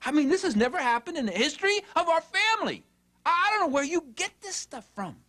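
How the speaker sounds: high-pitched, incredulous mock tirade; berating parent. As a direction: act a parent tearing into a son. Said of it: "you hear yourself saying".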